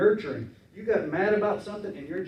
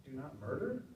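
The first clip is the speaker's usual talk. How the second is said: unimpressed question; 3 semitones lower, 11 dB quieter than usual. Shown as a demonstration. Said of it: "I thought you were gonna give us something challenging".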